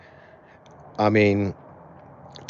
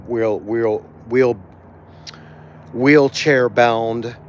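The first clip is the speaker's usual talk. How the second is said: choked up; 2 semitones higher, 5 dB louder, slower than usual. Real, not acted. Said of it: "I'm getting a little choked up".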